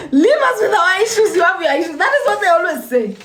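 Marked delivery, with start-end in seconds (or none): 0.1-2.0 mocking repeat. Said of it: "every South African says it".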